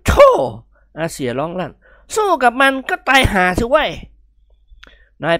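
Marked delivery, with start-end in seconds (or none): 1.0-3.9 cry out. Acted. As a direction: shout it in horror.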